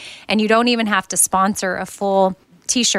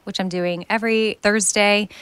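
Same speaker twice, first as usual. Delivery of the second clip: usual speaking voice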